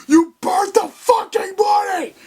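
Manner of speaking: screamed high and loud at a henchman; hammed-up villain rage; furious yell. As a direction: blow up at him over the money.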